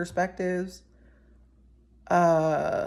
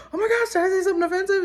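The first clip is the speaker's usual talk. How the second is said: flustered panic; 13 semitones higher, 3 dB louder, much faster than usual. Retold or imitated. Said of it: "Or I was like".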